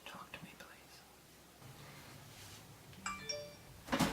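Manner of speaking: whispered plea; hushed begging whisper. Pretend. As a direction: whisper it close to the mic.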